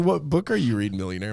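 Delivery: mocking high question to a pretend millionaire; teasing mentor.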